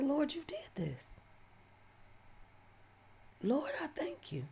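high, soft whispered prayer; breathless whisper. Retold or imitated. Alone in her room, whispering thanks to the Lord. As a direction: whisper it in awe to God.

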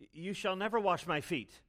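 Peter's firm refusal, stress on never; emphatic refusal. Retold or imitated. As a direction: refuse firmly, leaning on never. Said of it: "and Peter says to Jesus".

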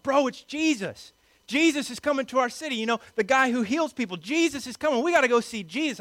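high, excited crowd yelling; excited fangirling yell. Acted. Crowd yelling that Jesus is coming to their city, excited fangirling. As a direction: gush like a starstruck fan, pitched well up. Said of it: "they start yelling".

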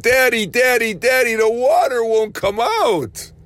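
mild mock child's scream for Daddy. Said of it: "she screamed".

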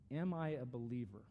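slow, contemplative reading. Real, not acted. Pastor giving congregation self-examining questions to contemplate, slow and spaced out. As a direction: read slowly, long pauses between questions. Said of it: "I'm gonna read them slowly".

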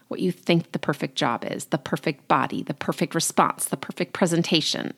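ordinary talk

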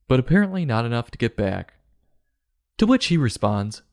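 ordinary talk, nothing marked

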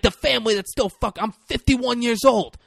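high, loud aggrieved rant; imitating an insulted mobster; acted outrage. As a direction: vent the grievance loudly, pitched well up. Acts out mobster's outraged quarrel.

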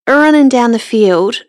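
usual speaking voice

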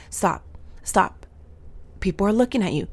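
usual speaking voice

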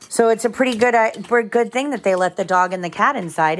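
usual speaking voice

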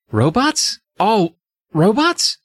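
high, rising disbelief; incredulous question. Acted. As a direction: play a stunned question, stretched out. Jerry learns all are robots.

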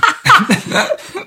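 a short high burst of laughter; laughing out loud. Real, not acted. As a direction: laugh out loud at your own joke. Joke about the jar.

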